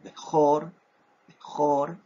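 slow Hebrew model word "mejor", bejor. Shown as a demonstration. Teacher modeling Hebrew bejor for students, careful. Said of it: "o se traduce como primogénito".

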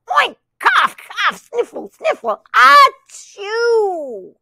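high oink, coughs and sniffles; sick oinking duck. Acted. Reading to a child, the duck oinks and sneezes. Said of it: "said the duck".

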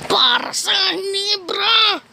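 rally the team with a long shout; rallying cry. Pretend. Gamer rallying his team with a slow battle shout.

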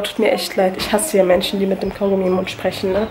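ordinary talk

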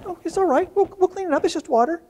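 put-on gently calm a little girl who spilled water; comforting a crying child. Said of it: "She goes".

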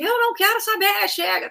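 high yelled "chega"; mock yell in his face; retold shout. Crew member shouting at producer, high yell. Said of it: "chegou e gritou na cara dele".

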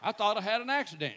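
ordinary talk

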